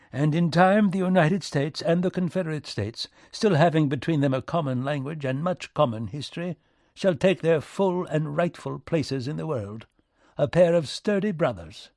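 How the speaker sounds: lofty, high-pitched British diplomat; courtly British minister, relieved. Acted. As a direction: pronounce it like a hopeful envoy.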